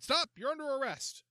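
high, nervous shout of arrest. A narrator's reading. Rookie officer jumping up to arrest the suspect, high shout. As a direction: rookie bursts out with his big line.

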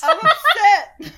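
high, loud, drawn-out mock wail; mock upset wail. Co-host reacting to the baby's sad line, mock upset wail. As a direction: wail it long and loud, half laughing. Joking cry after retold baby's words.

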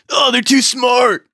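high, drawn-out exclamation of alarm; agent's overacted outburst; mock dismay. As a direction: cry it out high and stretched, hammed-up.

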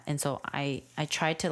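normal talking voice

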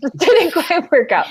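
laughing. Real, not acted. Laughing retelling of the fall.

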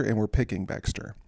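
ordinary delivery

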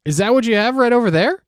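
high, quick, put-on wonder; excited high-pitched fake question; mock curiosity. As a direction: play along with feigned amazement. Staged surprise about mail.

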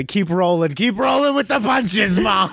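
high excited shout. Pretend. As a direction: yell it up high. Shouted encouragement.

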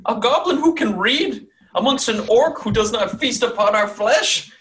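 high, loud, theatrical proclamation; omen-crying prophetess. Acted. Omen lady pointing at the scroll, shrieked proclamation. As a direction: cry it out as a dire prophecy.

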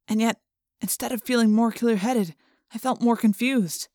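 ordinary delivery, nothing marked

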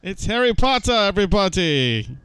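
American's high, hammed-up English accent; strange English accent. American mimicking a posh English accent for his British friend. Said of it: "That was the strangest English accent".

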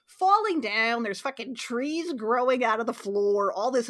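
usual speaking voice

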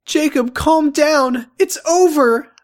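very high slow pleading; high character voice. Acted. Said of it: "I said while trying to calm".

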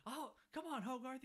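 mock whine of a fed-up beatnik; exasperated Dean. Dean fed up with Hogarth at the junkyard, high mock whine. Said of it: "he's like".

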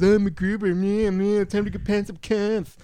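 a Val Kilmer-style MacGruber send-up; mocking MacGruber. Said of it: "puts on the ponytail and just goes".

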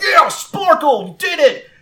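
loud, very high victory cheer; calling out in triumph. Acted. Trainer pumps fist for Sparkle, calling out joyfully. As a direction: cheer your winning Pokemon. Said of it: "calling out".

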